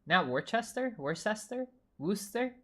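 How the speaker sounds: normal talking voice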